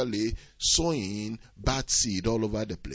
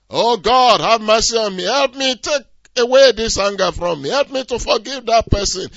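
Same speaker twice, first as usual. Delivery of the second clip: a louder, higher cry to God for help; pleading prayer; 10 semitones higher, 12 dB louder than usual. Acted. Believer begging God to take away anger, loud pleading.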